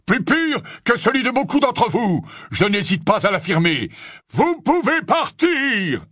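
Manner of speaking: loud, high-pitched booming proclamation; booming stentor voice. A narrator's reading. Harry as Runcorn dismissing the accused, booming. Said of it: "de sa voix de stentor".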